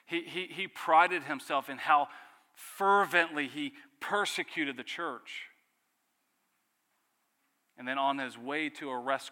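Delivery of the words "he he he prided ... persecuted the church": louder, higher, slowing on the persecution; rising fervor. Acted. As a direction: build to a preacher's pitch. Zealous Pharisee described.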